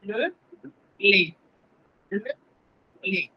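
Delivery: "le" repeated with a closed, rounded vowel; closed le drill. Shown as a demonstration. Teacher drills the closed "le" sound for students. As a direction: drill "le" slowly, three times. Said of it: "el sonido cerrado que tenemos que practicar".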